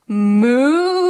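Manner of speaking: a lightly mooed word; mooing like a cow. Pretend. Hinting at the hidden cow, lightly mooed. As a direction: draw it out like a moo.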